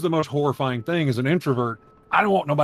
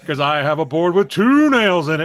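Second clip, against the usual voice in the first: boasting ghost; 5 semitones higher, 7 dB louder than usual. Retold or imitated. Ghost boasts in retold story.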